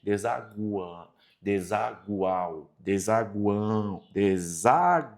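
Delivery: desaguão repeated slowly and softly, the U glide stressed; slow, soft pronunciation drill. Shown as a demonstration. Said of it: "a ideia do som do U".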